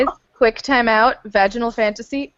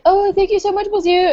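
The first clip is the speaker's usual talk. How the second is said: high breathy mock damsel gushing; 10 semitones higher than usual. Friend mocking the heroine's thanks, high gushing mock damsel. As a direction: ham up the grateful heroine, pitched way up. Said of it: "She's like".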